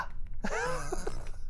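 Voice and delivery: airy, high laugh; breathless laugh. Real, not acted. Laughing at his own Hulk bit, airy laugh.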